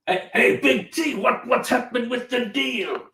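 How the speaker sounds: high, drawn-out mock American gangster; hammed-up crime-film audition voice; small-time drug dealer. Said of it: "You've gotta tell us that a deal's gone bad".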